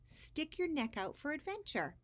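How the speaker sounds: a lightly voiced loving mom; mom's encouraging line. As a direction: urge him on kindly like a mother. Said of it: "she answered".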